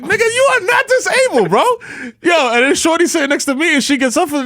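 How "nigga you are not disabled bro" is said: high-pitched incredulous retort; high mock retort. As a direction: squeak it out in disbelief. Retold retort to fat dude.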